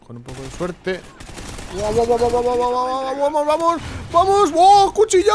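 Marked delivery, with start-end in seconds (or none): 1.8-5.3 high, loud burst of triumph; real gamer screaming at a great kill; ecstatic yelling. Real, not acted. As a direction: build from urgent chanting to an elated shout. Celebrating a triple knife kill.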